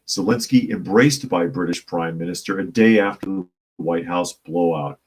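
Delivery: normal talking voice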